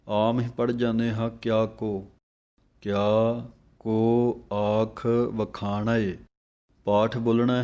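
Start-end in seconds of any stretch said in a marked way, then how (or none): 2.8-6.2 ਕਿਆ and ਕੋ said slowly as two separate words; don't run ਕਿਆ into ਕੋ; separated words. Shown as a demonstration. Teacher showing students to split ਕਿਆ ਕੋ, slowly. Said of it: "ਕਿਆ ਵੱਖਰਾ ਪੜ੍ਹਨਾ ਹੈ".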